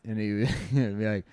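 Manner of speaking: normal talking voice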